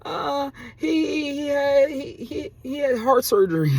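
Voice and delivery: high, halting fake sobs; mock crying. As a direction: imitate her tearful, stammering excuse. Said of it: "started crying, saying".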